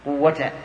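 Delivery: قوة said as "quwwata"; no tanween. Shown as a demonstration. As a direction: end قوة on an open -ta, no n sound. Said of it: "بدون تنوين".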